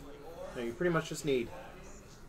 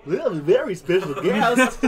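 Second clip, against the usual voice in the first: louder, higher showman intro, laughing through it; hammed-up announcer; 4 semitones higher, 11 dB louder than usual. Host redoing the guest intro, hammed-up announcer voice. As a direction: boom it out like a game show host. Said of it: "That doesn't sound rehearsed at all".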